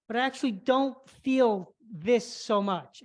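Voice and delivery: normal talking voice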